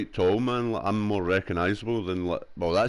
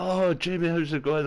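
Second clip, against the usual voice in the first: put-on cheery old classmate; 9 semitones higher than usual. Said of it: "they're like".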